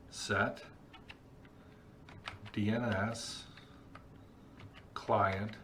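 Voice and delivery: slow word-by-word dictation while typing; dictating. Real, not acted. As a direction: read the command out piece by piece. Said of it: "we're gonna type this command".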